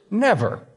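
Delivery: Never. pitched high, alone after a pause; emphatic never. Shown as a demonstration. Pastor stressing God's promise to the congregation, emphatic. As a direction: let Never. land by itself. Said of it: "That's capital N, capital E".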